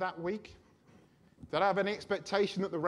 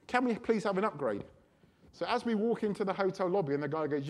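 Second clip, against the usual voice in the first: ordinary talk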